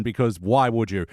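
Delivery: ordinary delivery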